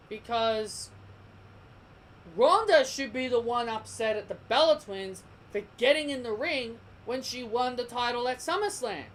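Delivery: normal talking voice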